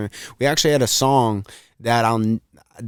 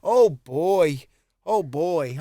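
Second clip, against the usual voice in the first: higher, lightly voiced old man; 4 semitones higher, much slower than usual. Retelling friend's catchphrase, lightly voiced old man. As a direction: mutter oh boy like a grandpa. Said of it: "He used to say this religiously".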